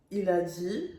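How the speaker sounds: ordinary talk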